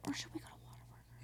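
whispered joke. Real, not acted. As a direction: breathe it as a sly aside. Joking suggestion, whispered aside.

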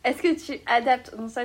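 normal talking voice

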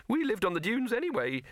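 high-pitched rabbit with a mild sigh. Acted. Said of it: "it sighed".

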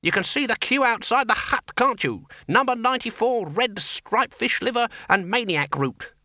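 high-pitched sarcastic rant; mock a ridiculous experiment, voice climbing high; scornful, singled-out numbers in a high querulous voice; nasty. A narrator's reading. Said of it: "said the Senior Wrangler nastily".

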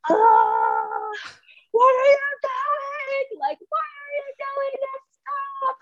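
very high, drawn-out mock wail; retold freak-out scream. Patient retelling her colonoscopy freak-out, screaming in mock panic. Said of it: "Just screaming the whole time".

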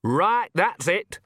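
slow, high, angry snarl; fed-up character outburst. A narrator's reading. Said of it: "snarled Nanny".

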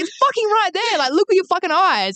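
high, exasperated yell; hammed-up fury at a friend; mock scream. Host repeating the yell at flatmate, mock scream. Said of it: "And I screamed at her".

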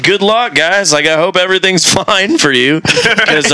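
high, giggly sarcastic goodbye; laughing mock farewell. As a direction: laugh through the joke.

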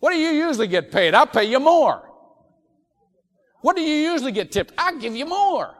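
an eager big-tipper's loud offer; loud generous offer. Shown as a demonstration. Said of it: "let me give you another line".

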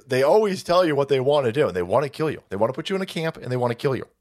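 ordinary talk

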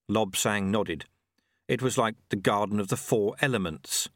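ordinary delivery, nothing marked

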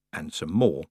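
usual speaking voice

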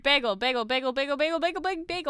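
"bagel" chanted fast, over and over; rapid-fire repetition. Shown as a demonstration. Streamer proving her pronunciation to co-host, rapid-fire repetition. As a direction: rattle off "bagel" without stopping. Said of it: "whenever you say it really fast".

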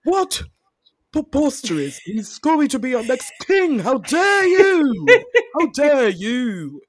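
high, drawn-out outraged English voice; hammed-up English indignation, stretching the words; indignant English accent. Mocking how England would react to losing Charles, indignant English accent. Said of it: "someone from, uh, England is like".

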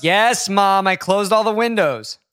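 hammed-up impatient son; exasperated son. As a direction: draw it out like a put-upon son. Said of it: "I'm gonna be Nick".

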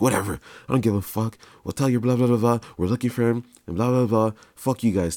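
loud, high, scornful rant; brushing the kids off in anger; angry dismissive cop. Retold or imitated. Cop dismissing them angrily.

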